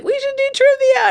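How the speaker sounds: excited high. Retold or imitated. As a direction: chirp it like an overeager friend. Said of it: "You're like".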